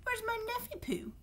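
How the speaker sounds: very high doting squeal; very high sing-song baby talk; Aunt Marge cooing. A narrator's reading. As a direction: squeal the pet name like a smothering aunt. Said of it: "roared Aunt Marge".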